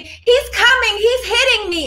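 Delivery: mock panicked caller. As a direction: ham up a false victim calling the cops. Mocking false caller to police.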